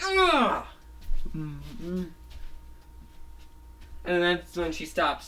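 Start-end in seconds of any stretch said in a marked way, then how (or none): 0.0-0.7 high, loud grunt of pain. Retold or imitated. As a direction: grunt as if punched. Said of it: "She's like".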